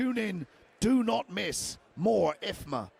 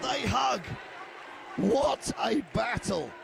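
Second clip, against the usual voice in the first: energetic live fight call; play-by-play commentary. Real, not acted.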